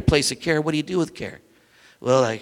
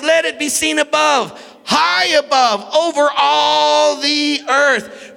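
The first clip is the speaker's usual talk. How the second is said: high, loud, slow preached psalm; fervent sermon reading rising to a shout; preaching at full force; 8 semitones higher, 3 dB louder than usual. Acted. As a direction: lift the reading up to a climax.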